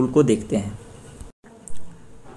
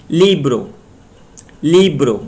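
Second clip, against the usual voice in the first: Libre with ली louder and bro falling away; stress on first syllable; 5 dB louder than usual. Shown as a demonstration. Teacher shows where stress goes, stressing ली in Libre. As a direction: punch the ली in Libre. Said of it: "ली पे stress दे".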